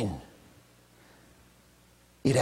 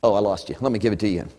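ordinary talk

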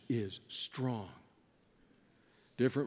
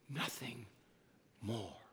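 very soft and lower; near-silent finality; hushed; 5 semitones lower, 14 dB quieter than usual. Acted. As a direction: let it die away. Hushed at deathbed image.

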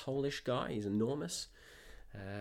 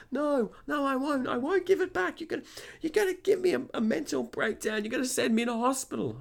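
very high, frantic mock refusal; pitched-up imitation of hysterics; hysterical customer. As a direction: mimic her meltdown. Retold customer in meltdown refusing.

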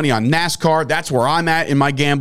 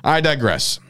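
normal talking voice

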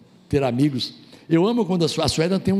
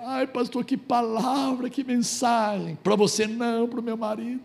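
high, teary imitation of a wife; mock tearful woman pleading with the pastor; lightly voiced tearful wife; weepy wife; 9 semitones higher than usual. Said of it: "veio chorando pra dizer".

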